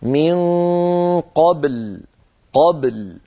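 قبل with the ب bouncing, echoing stop; من قبل, ب released with a clear bounce; qalqala on baa. Shown as a demonstration. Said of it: "تنطق الباء ساكنة مقلقلة هكذا".

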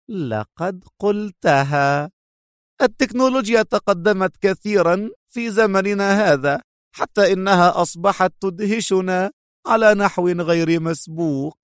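high-pitched, amused, superior woman's voice; mocking. A narrator's reading. Captive woman leaning to the screen to taunt her captor, mocking. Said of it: "تألقت ضحكة ساخرة مجلجلة في عينيها".

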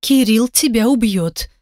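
mock-tragic doom, lightly voiced. A narrator's reading. Mother-in-law sees the burnt kitchen, tragic voice. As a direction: pronounce it like a grim verdict. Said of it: "трагическим голосом".

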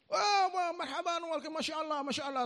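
high, delighted welcoming cries; welcoming newcomers to the mosque, high and overjoyed; excited welcome. Retold or imitated. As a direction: greet the guests with eager joy, pitched high. Congregation greets newcomers.

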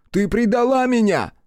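very high, loud betrayed yell; furious shout. A narrator's reading. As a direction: scream out the betrayal. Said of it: "закричал Хицу".

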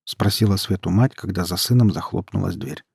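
ordinary delivery, nothing marked

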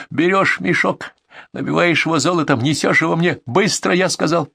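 high, insistent ordering; commanding tone. A narrator's reading. Bandit orders a man to bag the gold, high and commanding. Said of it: "приказным тоном сказал он".

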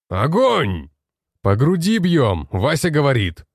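loud battle command; shouting orders. Acted. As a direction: bark commands over gunfire.

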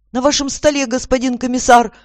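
high, loud, eager-to-serve voice; obliging. A narrator's reading. Young assistant to his chief, obliging and high. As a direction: answer the boss like a willing underling. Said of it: "услужливо ответил юноша".

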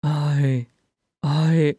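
halting, broken by a long pause; dumbfounded stammer. A narrator's reading. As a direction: falter as if lost for words. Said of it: "he stammered".

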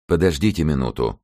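plain reading voice

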